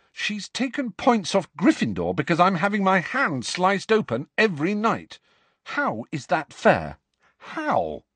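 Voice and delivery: put-on boy fuming to his friend; bitter complaint. A narrator's reading. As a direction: vent the injustice. Harry complains bitterly to Ron.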